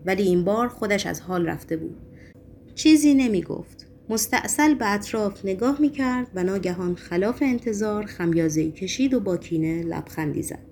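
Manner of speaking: usual speaking voice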